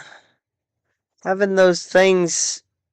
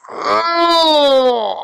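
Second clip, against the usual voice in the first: pained shout; 9 semitones higher than usual. Real, not acted. Gamer crying out as the game goes wrong. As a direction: let out a raw frustrated cry. Rage outburst while gaming.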